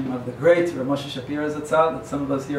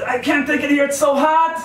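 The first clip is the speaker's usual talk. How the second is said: comic complaint; 10 semitones higher, 4 dB louder than usual. Retold or imitated. Joke character begs from hell.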